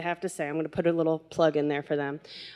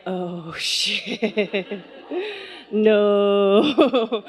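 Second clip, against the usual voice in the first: laughing dismay; much slower than usual. Retold or imitated. Seeing pink windshield.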